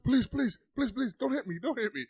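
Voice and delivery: high-pitched mock begging; scared pleading. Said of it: "He was scared as shit".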